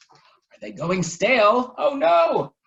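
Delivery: high, loud oh no; mock alarm. A narrator's reading. Narrator echoes the scare, high and alarmed. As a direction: gasp it out in mock horror.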